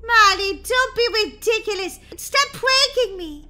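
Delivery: high, loud sisterly telling-off; exasperated scolding. Acted. Sister who thinks it's a prank, exasperated scolding.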